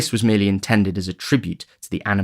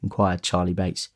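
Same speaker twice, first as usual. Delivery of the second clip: usual speaking voice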